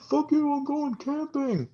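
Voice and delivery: put-on high distant drunken holler; from the distance. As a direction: yell it from far off in the woods. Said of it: "you just hear in the distance".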